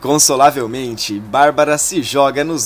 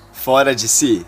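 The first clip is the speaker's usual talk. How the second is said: ordinary delivery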